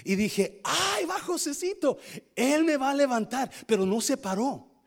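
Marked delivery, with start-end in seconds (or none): 0.6-3.5 high, eager hopeful cry; hopeful excitement. Retold or imitated. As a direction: spot the friend's car and light up. Hopes the car will stop. (emoción esperanzada, aguda)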